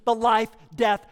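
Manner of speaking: ordinary talk, nothing marked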